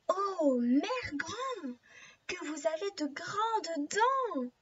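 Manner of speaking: slow, drawn-out storybook wonder; astonished girl. Acted. Girl notices Grandmother's odd eyes, slow swooping astonishment. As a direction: marvel slowly, stretching each phrase. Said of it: "étonnée".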